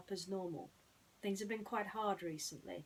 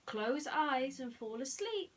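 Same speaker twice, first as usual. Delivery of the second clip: explaining mouse voice; 6 semitones higher, 4 dB louder, slower than usual. Acted. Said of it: "Susie explained".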